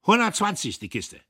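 same high loud officer price, insisting; stubborn lieutenant; stubborn repeat. A narrator's reading. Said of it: "blieb dabei".

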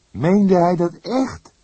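can't believe what he hears; incredulous young detective. Acted.

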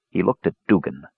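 ordinary delivery, nothing marked

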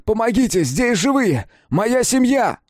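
high, loud cries for help; man finding his buried family, desperate shouts. A narrator's reading. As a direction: scream for rescuers to come.

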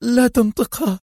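high, weeping plea; woman pleading with her boss not to say it; tearful pleading. A narrator's reading. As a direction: beg through tears. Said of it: "ثم انهمرت دموعها كالسيل".

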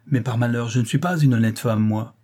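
usual speaking voice

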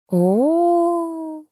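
long high moaned "oh". A narrator's reading. Katya hears she must not ask for details, moaning. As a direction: groan out a drawn, high "oh". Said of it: "простонала Катя".

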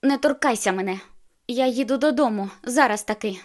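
loud angry refusal. A narrator's reading. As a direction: push him away with the voice. She refuses angrily.

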